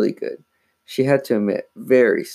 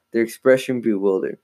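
ordinary delivery, nothing marked

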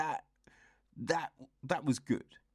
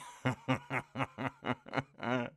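breathy laugh. Real, not acted. Laughing at own impression.

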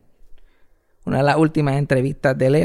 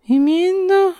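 put-on squeaky high child voice, stumbling; a tiny boy trying again; little kid voice; 3 dB louder, much slower than usual. Little boy repeating after mother, high-pitched. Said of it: "yo no casi ni hablaba".

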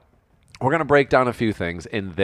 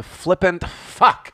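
fuck said slowly and higher; savoured swear word; 8 semitones higher, 3 dB louder, much slower than usual. Shown as a demonstration. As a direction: stretch out flippant fuck with relish. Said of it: "I'll use the term one more time".

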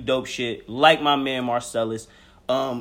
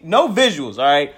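ordinary talk

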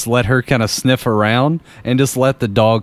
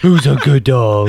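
laughing dog talk; 4 dB louder than usual. Pretend.

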